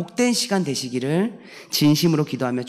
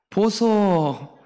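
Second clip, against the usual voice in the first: higher Gyeongsang call, drawn out very slowly; Gyeongsang dialect; 4 semitones higher, much slower than usual. Shown as a demonstration. Pastor showing the congregation how to order in Gyeongsang dialect, slow and higher. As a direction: call the shop owner the Gyeongsang way, stretching 보소 slowly. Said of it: "이 말은".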